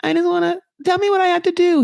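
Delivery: high mock begging; wife pleading with husband, high and imitated; upset pleading. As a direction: imitate her begging him, pitch up. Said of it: "She's so upset".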